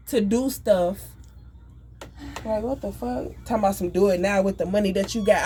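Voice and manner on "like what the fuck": ordinary talk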